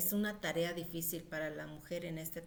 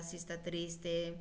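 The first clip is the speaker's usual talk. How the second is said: ordinary talk, nothing marked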